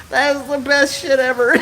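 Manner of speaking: high delighted laughing speech; crying laughing. Real, not acted. Streamer praising a viewer's story, breaking into high laughter. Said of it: "I'm crying laughing".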